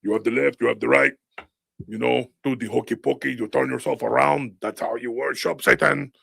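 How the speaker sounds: lightly voiced mock speech of a politician. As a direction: imitate a leader preaching nonsense. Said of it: "B B gets up there and he goes".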